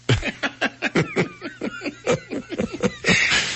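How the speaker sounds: high-pitched squealing laugh. Real, not acted. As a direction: burst into a squeaky giggle. Laughing at own joke.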